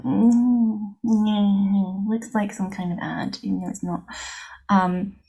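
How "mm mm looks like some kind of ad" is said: sceptical mock muse. Looking at a business profile, doubting: hushed sceptical hum. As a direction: mimic squinting at an ad, half under the breath. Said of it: "I'm like".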